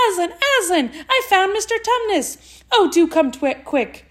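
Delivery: high, thrilled calling out; excited shout. A narrator's reading. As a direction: call out with excited joy. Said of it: "shouting out".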